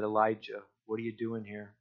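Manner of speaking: very soft, hushed voice of God; still small whisper. Acted. God speaking to Elijah in a still small voice, hushed. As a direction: barely breathe the question. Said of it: "there was a still small whisper".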